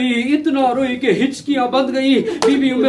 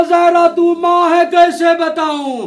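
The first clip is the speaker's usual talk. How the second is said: grieving father; 6 semitones higher, 5 dB louder than usual. Retold or imitated. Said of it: "रसूल खुदा ने रो कर कहा".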